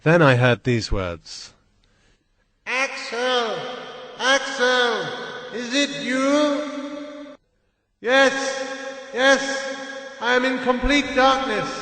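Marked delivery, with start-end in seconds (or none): 2.6-7.4 high, slow call from far away; uncle calling. A narrator's reading. 8.0-11.7 high, urgent answer called into the dark; boy answering his uncle from far away; calling back. A narrator's reading.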